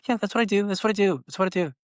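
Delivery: high, mocking kid voice, repeating it over and over; feisty daughter. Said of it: "she was getting kinda feisty with me".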